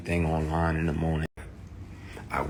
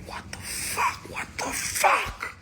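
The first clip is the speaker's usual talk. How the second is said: stunned whisper; slower than usual. Retold or imitated. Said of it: "I'm like".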